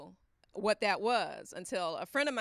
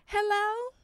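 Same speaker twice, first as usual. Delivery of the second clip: high, louder coy hello; flirty high hello; 11 semitones higher, 6 dB louder than usual. Retold or imitated. Wife answering her man's call, coy and high. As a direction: answer all coy and sweet.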